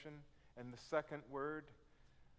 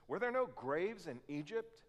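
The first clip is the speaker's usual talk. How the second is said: loud, higher, accusing protest; raised mock outrage at God; indignant complaint; 7 semitones higher, 6 dB louder than usual. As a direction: hurl the accusation at God like an aggrieved Israelite. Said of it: "it comes out like this".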